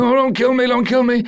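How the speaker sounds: high, loud whimpering plea; pleading Hans. Retold or imitated. Imitating Hans begging not to be killed, high and loud. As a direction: beg for your life in a squeaky panic. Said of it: "Hans has this moment of vulnerability".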